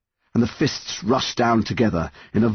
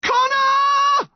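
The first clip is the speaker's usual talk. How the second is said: very high, long scream of a name; panicked yell; 4 dB louder than usual. A narrator's reading. Mum losing her grip, yelling to her son. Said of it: "his mum yelled".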